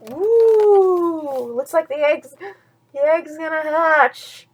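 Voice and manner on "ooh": ordinary delivery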